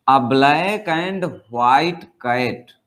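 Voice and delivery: each word spelled out very slowly; slow dictation. Shown as a demonstration. Teacher reads the example to the class, very slow. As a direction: read it out slowly like dictation for students.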